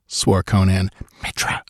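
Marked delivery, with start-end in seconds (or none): 1.2-1.6 breathless frightened whisper; whispered oath. A narrator's reading. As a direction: gasp the god's name in a whisper. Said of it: "whispered Valeria".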